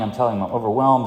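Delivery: ordinary talk, nothing marked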